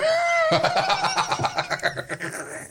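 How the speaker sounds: high, long laughing fit; helpless laughter trailing away; real burst of laughter. Real, not acted. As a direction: crack up at your own punchline. Laughs after own punchline.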